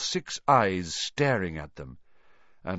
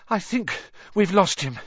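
out of breath, higher pitched; panting; 9 semitones higher than usual. A narrator's reading. Harry to friends after fleeing Filch, panting. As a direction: pant it out after running. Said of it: "Harry panted".

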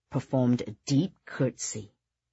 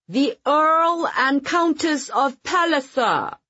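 slow, loud, high ceremonial call; booming voice; 11 semitones higher, 4 dB louder, slower than usual. Acted. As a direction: boom out the names across the hall. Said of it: "called out in a booming voice".

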